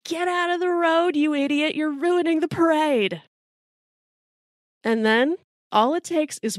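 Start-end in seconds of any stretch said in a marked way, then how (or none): 0.0-3.2 mock yell from an angry parade crowd; imitated crowd yelling. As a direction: pitch it up like an irritated onlooker heckling. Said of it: "the crowd starts yelling at Hamilton".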